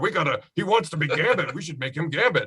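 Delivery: mock eager executives. Host imitating studio people backing their star, mock eager. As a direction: imitate flustered studio people talking themselves into it.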